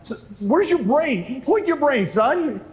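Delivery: high, hammed-up lecture; staged scolding. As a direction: put on a stern show for the crowd. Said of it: "I'm doing this kinda for the parents".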